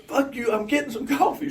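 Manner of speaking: high, cocky retort; hammed-up swagger; mock defiant. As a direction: toss it off defiantly. Retold comeback, loud high.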